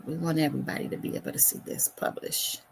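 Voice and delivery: fast, breathless whisper to herself; whispered aside. Real, not acted. Host publishing the stream, whispering to herself. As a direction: rush it under your breath. Whispering while clicking settings.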